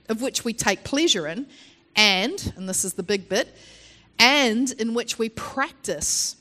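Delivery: ordinary delivery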